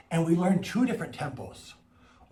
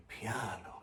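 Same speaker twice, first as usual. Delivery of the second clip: low, quiet demonstration; hushed and lowered; piano, soft; 4 semitones lower, 16 dB quieter than usual. Shown as a demonstration. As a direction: say it softly to model quietness. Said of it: "soft or quiet".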